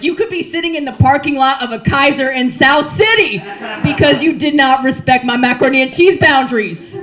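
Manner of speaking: loud, laughing mock threat; warning the husband over macaroni, shouting. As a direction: yell the warning half laughing.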